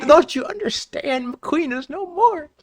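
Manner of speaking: high, slow, hammed-up lament; high mock grief, drawn out; mock raging. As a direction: wail it like a melodramatic mourner. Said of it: "you're screaming, you're raging".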